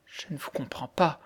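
soft voice changed by shock; altered, shaken voice. A narrator's reading. Girl stunned by the widow's news, altered soft voice. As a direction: say it faintly, as if not yourself. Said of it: "d'une voix tellement altérée".

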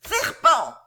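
high, loud piercing shriek of an insult; shrill saw-like cry. A narrator's reading. Editor snarling at his guest, high sawing screech. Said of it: "avec une voix semblable au cri aigu d'une scie".

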